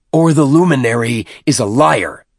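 high and loud, spat out; angry snap. Acted. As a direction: snap it back in his face. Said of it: "Tartarus snapped".

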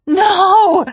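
high, loud screamed refusal; desperate scream. A narrator's reading. Cortana resisting the Gravemind, desperate scream. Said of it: "she screamed".

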